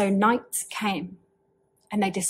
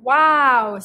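a loud, bright burst of delight; excited wow; 4 semitones higher, 8 dB louder than usual. Acted. Chicken thrilled to be invited, shouting wow. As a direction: gasp it out with joy.

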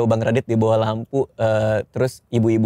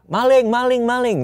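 mock alarm shout; 9 semitones higher than usual. Comedian imitating boarding-house residents crying thief, high mock shout. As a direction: imitate people calling out, pitched way up. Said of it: "pada teriak".

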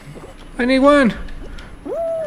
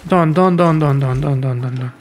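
fading defeat; 10 semitones lower than usual. Real, not acted. Giving up after losing.

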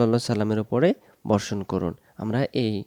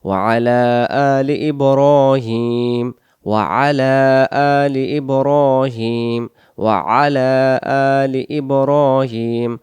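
slow recitation; much slower than usual. Shown as a demonstration. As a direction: chant it slowly, long vowels. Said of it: "এরপরে আমরা পড়ি". (ধীর তিলাওয়াত)

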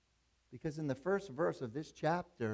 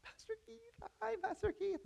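high, sing-song falsetto greeting; mock sugary hello from a car window; sweet woman's greeting. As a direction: chirp it like a woman waving from a car. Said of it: "she's waving at me".

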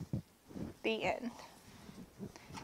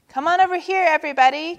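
high, raised sing-out call; 7 semitones higher, 4 dB louder, much faster than usual. A narrator's reading. Zebra gathers everybody around, calling out. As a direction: call the group over. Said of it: "called Zebra".

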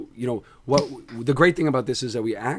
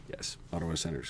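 whisper; 5 semitones lower, 13 dB quieter, faster than usual. Real, not acted. Fan whispering team name hoarsely.

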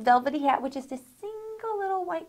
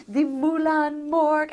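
normal talking voice

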